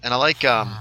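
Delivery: ordinary delivery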